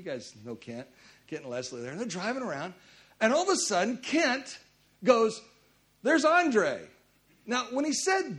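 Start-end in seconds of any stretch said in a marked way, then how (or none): 6.0-6.8 excited spotting. Retold or imitated. Spotting a celebrity from the car.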